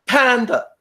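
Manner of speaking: high, singsong mock student answer; an eager, rote exam answer, pitched high. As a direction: chirp it like a rote learner. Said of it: "rote student answer".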